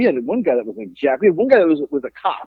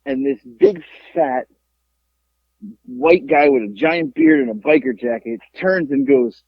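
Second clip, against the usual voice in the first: ordinary delivery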